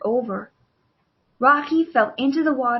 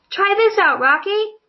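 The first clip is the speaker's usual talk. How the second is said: high, louder, eager call to a friend; excited call; 9 semitones higher, 5 dB louder than usual. Acted. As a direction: call out to him, thrilled to share. David calls out to Rocky.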